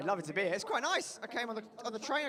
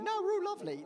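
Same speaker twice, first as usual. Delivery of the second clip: mock offended freshers; 12 semitones higher, 4 dB louder than usual.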